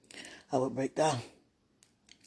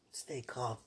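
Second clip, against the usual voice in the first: ordinary talk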